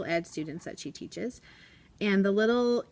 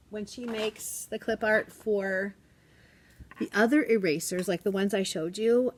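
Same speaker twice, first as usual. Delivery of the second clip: normal talking voice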